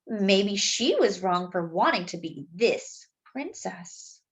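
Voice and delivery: put-on gentle read-aloud for children; story narration. A narrator's reading. Narrator reading a story to young listeners, gently. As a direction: tell it like a bedtime picture book. Reads the picture book aloud.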